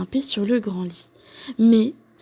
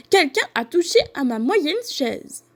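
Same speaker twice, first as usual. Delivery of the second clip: higher-pitched, mildly irritated cry; lightly acted irritation, pitched up; irritated mama bear; 6 semitones higher than usual. A narrator's reading. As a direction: exclaim as a put-out mother bear. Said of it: "d'une voix irritée".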